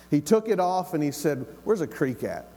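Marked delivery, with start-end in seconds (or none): 1.6-2.5 put-on lightly Southern-accented question; southern boy accent. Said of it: "That sounds like a southern boy".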